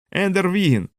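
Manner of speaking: put-on boy cheering Ender's name; cheering shout. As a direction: call the name out like a chant start. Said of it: "крикнув він".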